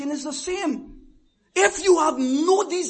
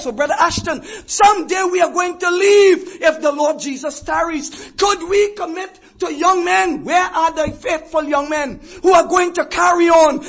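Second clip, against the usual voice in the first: loud, rising pulpit preaching; full-force preaching; 4 semitones higher, 9 dB louder than usual. Acted. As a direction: thunder it out to the congregation.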